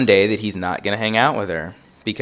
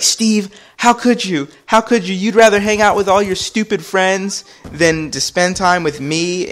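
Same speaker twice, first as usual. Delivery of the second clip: high-pitched mock wailing accusation; distraught girlfriend; 10 semitones higher, 3 dB louder than usual. Mom accuses Steve of choosing friends over her, distraught. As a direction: imitate an upset woman pleading in high voice. Mom's hurt accusation on the court.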